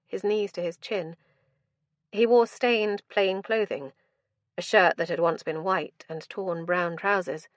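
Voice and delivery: plain reading voice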